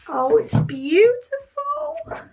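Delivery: high, slow, swooning squeal; real adoring delight; delighted coo. Real, not acted. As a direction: coo it high, stretching the last word. Admiring the notebook.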